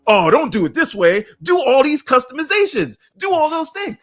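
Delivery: high-pitched imitation of insistent PC fans; hammed-up pushy advice; mock PC dudes. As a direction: mimic PC fans piling on demands, pitch climbing. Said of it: "you P C dudes will do this".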